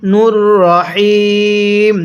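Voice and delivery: নূর রহিম slow, রহিম drawn out; Quran recitation. Shown as a demonstration. Teacher modelling নূর রহিম for viewers, slow recitation. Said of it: "এরপরে".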